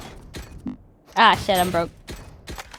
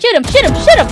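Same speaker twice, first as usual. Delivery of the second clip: urgent shout, much higher and louder; 11 semitones higher, 9 dB louder, much faster than usual. Real, not acted. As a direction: yell the callout urgently. Urgent callout during fight.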